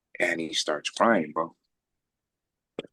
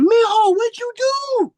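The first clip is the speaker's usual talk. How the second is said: much higher mock nurse voice; 8 dB louder, slower than usual. Retelling the nurse asking what he did, high mock voice. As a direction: play the startled nurse, pitched way up.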